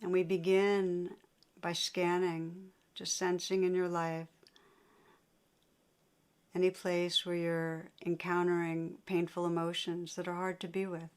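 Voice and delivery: unhurried meditation guidance with long pauses; guided meditation. Real, not acted. Teacher guiding listeners to sense painful emotions, guided meditation. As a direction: lead them inward, leaving long silences between phrases.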